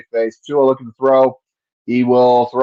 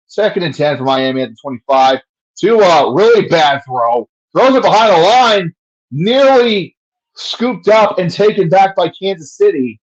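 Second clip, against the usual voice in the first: higher and louder live call; excited play-by-play; 5 semitones higher, 4 dB louder than usual. Acted. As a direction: call the near fumble urgently.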